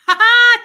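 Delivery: high burst of ha ha laughter; loud laugh. Real, not acted. Reading a funny comment from chat, loud laugh. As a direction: let out a big laugh.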